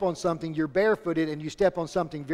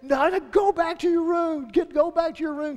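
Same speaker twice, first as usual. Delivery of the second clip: yelling; 11 semitones higher than usual. Retold or imitated. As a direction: shout the kids back upstairs. Parent yelling at scurrying kids.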